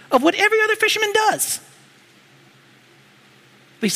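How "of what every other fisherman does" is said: exasperated Peter. Retold or imitated. Peter's peak of frustration.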